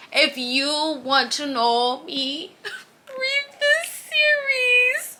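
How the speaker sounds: slow, high, cracking plea through a teary laugh; heartfelt crying laugh; emotional plea. Real, not acted. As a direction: beg them to read it, voice breaking. Emotional plea about favorite series.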